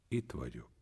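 soft, low, hushed reply; quiet. A narrator's reading. Master stops before the sufferer and answers, quietly. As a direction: answer very gently under your breath. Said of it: "csendesen így szólt".

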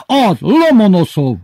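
high, loud, drawn-out triumph; triumphant shout. A narrator's reading. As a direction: yell the name as if victorious. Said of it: "ordította diadalmasan az igazgatóeltárs". (diadalmas ordítás)

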